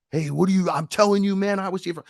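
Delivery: high-pitched imitation of agitated quarrelling; mock aggressive arguing. Mimicking aggressors in post office fight, mock agitated. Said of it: "aggressives got loud again".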